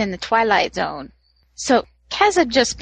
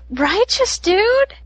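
surfer dude; 10 semitones higher, slower than usual. Retold or imitated. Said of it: "I'm like".

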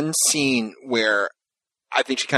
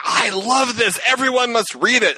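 higher, eager gushing praise; genuine enthusiasm; 11 semitones higher, 3 dB louder, slower than usual. Retold or imitated. About a fic she read, gushing excited praise. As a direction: gush like you really mean it. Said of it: "that was very genuine".

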